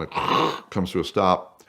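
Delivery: ordinary talk, nothing marked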